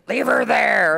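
dad's high, loud mock holler; retold angry yell. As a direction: imitate an irate father yelling across the room. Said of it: "heard my dad cussing and cussing and screaming".

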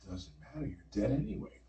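low, soft, dismissive reply; cool retort. Retold or imitated. Dreamer to dying gunman, cool dismissal. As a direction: drop the voice and brush him off.

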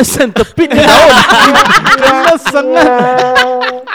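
laughing through the punchline; cracking up mid-sentence. Real, not acted. Funny story punchline.

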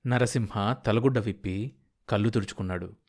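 usual speaking voice

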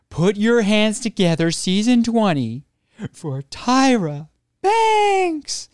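high, loud show introduction with drawn-out name; big build-up, stretching the star's name; hype announcer. Retold or imitated. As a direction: imitate an MC whipping up the room. Staff announce Tyra to the room.

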